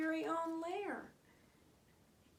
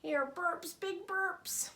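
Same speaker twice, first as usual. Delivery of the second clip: ordinary delivery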